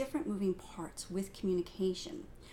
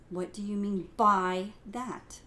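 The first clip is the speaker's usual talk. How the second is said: "by" louder and lifted, slow; stress on BY; 6 dB louder, slower than usual. Shown as a demonstration. Teacher shows stress on "by" in the question. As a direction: hit "by" firmly. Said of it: "depending on the emphasis that I put on each word".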